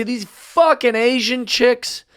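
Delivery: slow, high-pitched mock contempt; demonstrated slur-like outburst. Shown as a demonstration. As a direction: draw out an exasperated complaint for effect. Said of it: "do you see how that sounds different".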